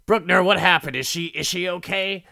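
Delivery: a worried man calling out for her; urgent, frantic questions; panicked hero. Retold or imitated. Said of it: "he goes".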